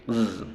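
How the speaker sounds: "Z" as a sustained buzzing "zzz"; voiced Z. Shown as a demonstration. Teacher showing the vibration, a voiced buzzing Z. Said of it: "la Z es sonora. Vibración".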